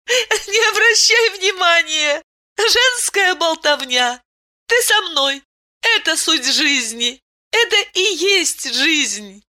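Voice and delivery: Aylen's voice. A narrator's reading. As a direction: voice her gently, well above your own.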